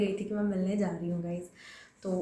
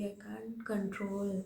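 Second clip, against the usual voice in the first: very slow, hushed whisper; whispered admission; 5 dB quieter, much slower than usual. Real, not acted. Fan admitting she couldn't stop crying, whispering. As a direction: let it slip out under the breath.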